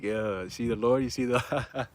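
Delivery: low, slow, pleased murmur breaking into laughter; smiling. Retold or imitated. Brothers watching mom's reaction on FaceTime, smiling. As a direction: say it through a knowing smile. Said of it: "just smiling, like".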